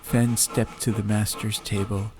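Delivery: plain reading voice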